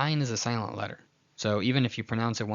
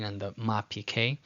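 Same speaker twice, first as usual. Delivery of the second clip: ordinary talk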